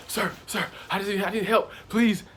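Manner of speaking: high, rising desperate plea; reliving the panic, begging a cop; panicked pleading. Retold or imitated. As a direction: beg for help in a panic. Retelling his panicked plea to cop.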